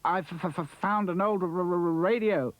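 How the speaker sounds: stuttering on every first sound; stammering. Acted. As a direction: trip over the consonants. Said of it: "he stammered".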